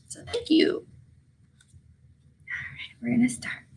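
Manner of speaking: soft whisper before the story. Real, not acted. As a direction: hush the class before reading. Teacher hushes before reading.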